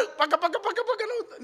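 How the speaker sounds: high, soft mimicry of a laughing crowd; high-pitched mock laughter, kept quiet; imitated cackling. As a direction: chuckle the syllables as you imitate everyone laughing. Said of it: "అందరూ నవ్వుతున్నారు".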